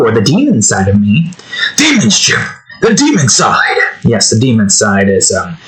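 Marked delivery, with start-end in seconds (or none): 1.8-3.8 high, mock-dramatic outburst; sudden high theatrical call; hammed-up exclamation. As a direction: cry it out like a campy warning. Hammed-up aside on his demon side.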